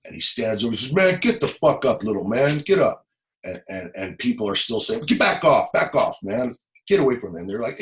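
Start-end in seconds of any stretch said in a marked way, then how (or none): 0.9-3.1 imitated tough-guy order, pitched up; gangster's urgent command. Gang member orders boy up. 5.1-7.4 protective warning yell. Retold or imitated. Gang member warns off crowd.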